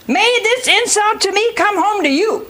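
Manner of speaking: high-pitched indignant wife imitation; acted blame. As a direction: hurl the blame at the husband, acted. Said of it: "She blames him".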